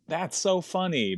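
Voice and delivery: high, drawn-out gushing; delighted audience. Retold or imitated. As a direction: imitate an audience loving the joke.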